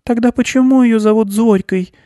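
put-on high-pitched little girl's voice; curious girl. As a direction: ask in a high child's voice. Child character asks in story.

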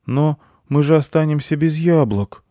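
put-on high, loud child's voice; protesting boy. A narrator's reading. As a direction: object in a child's pitch. (высокий протестующий детский голос)

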